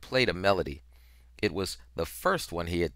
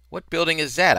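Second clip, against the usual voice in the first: high, anxious and louder; urgent raised question; 11 semitones higher, 7 dB louder than usual. A narrator's reading. Boy asks a stranger about the building, urgent and raised. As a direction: blurt it out, desperate to know.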